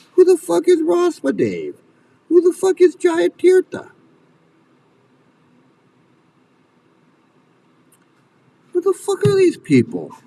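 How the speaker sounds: very high, incredulous angry questioning; real outrage. Real, not acted. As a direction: pitch shooting up in exasperated fury. Furious rant about the cult leaders.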